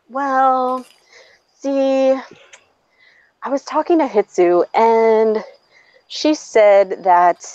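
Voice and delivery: halting, drawn-out hedging; lightly voiced hesitant messenger; reluctant boyfriend. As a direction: stall between phrases while imitating him. Retold reluctant boyfriend breaking news.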